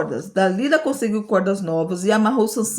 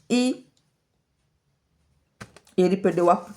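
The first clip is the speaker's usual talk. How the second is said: usual speaking voice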